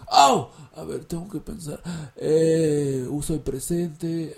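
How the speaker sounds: lightly voiced pondering learner; hesitant student thinking aloud. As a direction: act out a student slowly working out the grammar. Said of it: "vas a decir".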